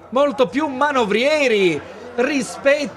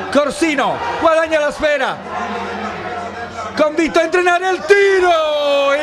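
excited commentary. Real, not acted. Shot just wide, live commentary.

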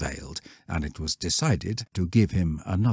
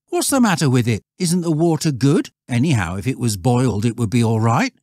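put-on loud, raised boy's voice; impatient schoolboy; 6 semitones higher, 6 dB louder, faster than usual. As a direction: lad arguing back. Voices Stanley.

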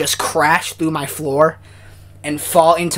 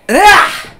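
loud, much higher yell mid-swing; effort scream; 13 semitones higher, 7 dB louder than usual. Real, not acted. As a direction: let out a big scream. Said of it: "I don't wanna keep screaming".

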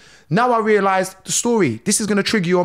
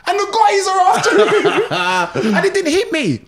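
very high, drawn-out through laughter; helpless high-pitched amusement; laughing in disbelief; 13 semitones higher, 4 dB louder than usual. Real, not acted. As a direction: squeal it, cracking up. Cracking up at his predicament.

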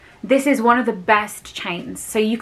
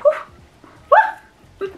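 excited whoop; 4 dB louder than usual. Real, not acted.

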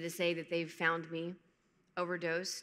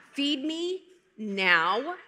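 slow, drawn-out hungry demand, pitched high; mock-stern model demanding food, each word stretched; hangry; 5 semitones higher, 5 dB louder, much slower than usual. Hungry runway model demands food, hangry and drawn out. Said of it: "That hangry look".

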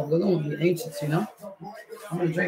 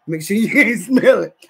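mock French accent; 4 semitones higher, 8 dB louder than usual. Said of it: "Put that French accent to it".

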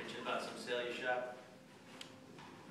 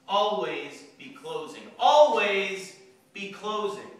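loud ALWAYS, louder and higher on the repeat; emphatic slogan; 7 semitones higher, 12 dB louder, slower than usual. Acted. Boss drilling the sales motto into his staff, emphatic slogan. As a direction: drill it in, punching each ALWAYS harder.

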